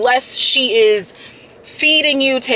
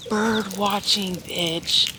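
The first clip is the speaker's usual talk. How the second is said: ordinary talk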